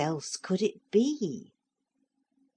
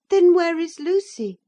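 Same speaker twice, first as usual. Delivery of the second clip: put-on high, loud worried child; a boy pleading for his friend; high urgent boyish voice; anxious boy; 13 semitones higher, 11 dB louder than usual. A narrator's reading. Boy Philip's voice.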